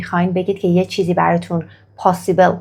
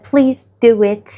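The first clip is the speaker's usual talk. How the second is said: very slow, each word spaced out; slow polite request; 3 semitones higher, 4 dB louder, much slower than usual. Shown as a demonstration. Teacher modelling a polite request very slowly. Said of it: "درخواست کنیم که لطفاً".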